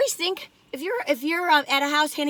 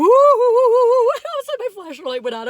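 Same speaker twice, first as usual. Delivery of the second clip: high, loud startled cry with a laugh; real fright turning into laughter; 12 semitones higher, 8 dB louder than usual. Real, not acted. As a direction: jump out of your skin, laughing. Car lights suddenly turning on.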